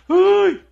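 high loud mock "¡ay!" wail. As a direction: wail it like a ruined girl. Mock cry of ruined life. (lamento fingido muy agudo)

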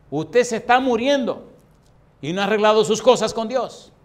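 reprensión a voz en cuello, cada vez más alta; regaña con toda la fuerza del predicador; regaño severo. Acted. Pastor regaña al moribundo, recreado. (regaño indignado a gritos)

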